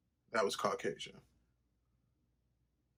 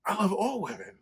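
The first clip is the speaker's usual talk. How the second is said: worked up; 8 semitones higher, 5 dB louder than usual. Retold or imitated. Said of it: "I'm like".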